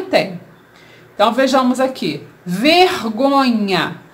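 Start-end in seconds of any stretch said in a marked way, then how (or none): 2.4-4.0 "Vergonha" said slowly, all joined up; slow, clear reading of "Vergonha" as one word; teacher slowly presenting "Vergonha" unbroken; careful whole word. Shown as a demonstration. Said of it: "Como é que eu vou dividir?".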